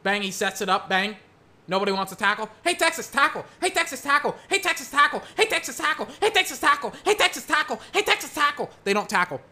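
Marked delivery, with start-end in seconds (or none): 2.6-8.7 much higher sing-song taunt; mock heckling. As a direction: needle them over and over. Mock heckling defenders, repeated.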